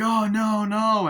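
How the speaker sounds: high, loud mock panic; loud hammed-up horror; panicked cry. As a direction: shriek as the man is shot. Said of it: "she's like".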